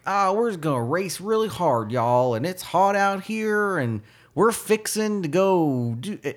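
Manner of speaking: high, slow hammed-up Texan accent; imitating a Texan accent. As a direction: drawl it like a driver faking Texas. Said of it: "he put on American accent".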